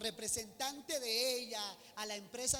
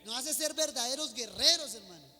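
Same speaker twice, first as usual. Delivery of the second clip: loud, emphatic sermon proclamation; booming preacher's declaration, key words stretched; preaching at full force; 4 semitones higher, 14 dB louder than usual. Real, not acted.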